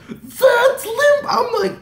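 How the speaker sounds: very high, loud fangirl squeal; voice going high. Retold or imitated. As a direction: squeal it out, voice shooting way up. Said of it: "My voice is going high".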